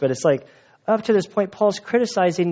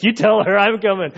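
raised, louder mock challenge; acted challenge; 6 semitones higher, 4 dB louder than usual. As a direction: throw down the challenge, higher and louder. Acted challenge from a competitive contest story.

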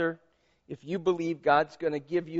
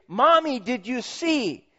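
put-on high eager child's call; a hint of a kid asking mom; lightly voiced child wanting praise; excited child; 7 semitones higher, slower than usual.

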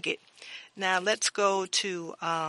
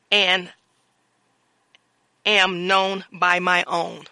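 louder, measured sermon reading of scripture; 5 dB louder than usual. Acted. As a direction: proclaim the verses to the congregation.

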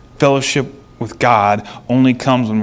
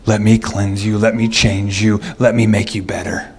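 tender God; 6 semitones lower than usual. Retold or imitated. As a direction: speak gently as God inviting them home. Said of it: "like Grandma, is sitting there".

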